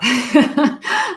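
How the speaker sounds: a bright burst of laughter; short real laugh. Real, not acted. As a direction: laugh at your own quote. Laughing at the quote.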